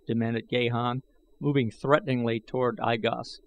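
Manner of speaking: ordinary delivery, nothing marked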